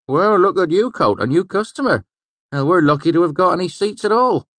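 high, loud regular's banter; jovial tavern regular. Acted. Tavern regular to innkeeper about new customer, jovial banter.

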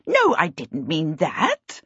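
slow, emphatic impatient correction; stretched-out, testy denial. A narrator's reading. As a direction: impatiently set them straight, drawn out. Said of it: "said impatiently".

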